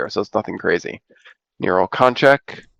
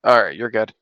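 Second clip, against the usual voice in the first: ordinary talk, nothing marked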